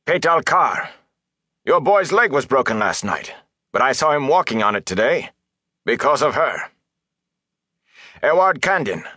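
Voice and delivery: high-pitched, insistent reproach; stern rebuke. A narrator's reading. Mayor reminding villagers whom she healed, reproachful.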